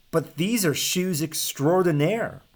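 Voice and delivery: light up as you offer them; beaming Hans. Acted. Hans proudly presenting the shoes to the giant, beaming. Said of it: "beamed Hans".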